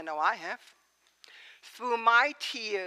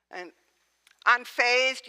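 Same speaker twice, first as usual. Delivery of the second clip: ordinary talk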